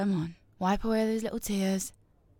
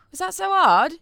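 mocking taunt; 10 semitones higher, 7 dB louder than usual. Mocking loud question.

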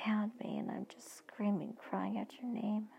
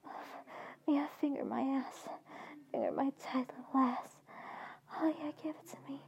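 higher, louder, desperate moaning cries; crying out; 5 semitones higher, 3 dB louder than usual. Acted. Woman crying out to Benjamin mid-fantasy, pleading and loud. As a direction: cry out his name, begging. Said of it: "and I cry out".

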